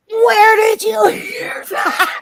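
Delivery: high, slow, loud shocked mom; shocked mother. Retold or imitated. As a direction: shriek it like a horrified mother. Shocked mother questions child.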